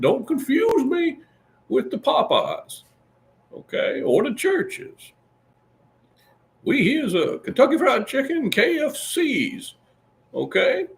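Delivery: high Southern old colonel voice, hammed up; Colonel Sanders impression. Host doing the Colonel to his listeners, high and hammed up. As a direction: sell the chicken as the Colonel.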